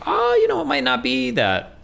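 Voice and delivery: high-pitched, hedging suggestion; tentative challenge. Retold or imitated. Acts out gently challenging a client.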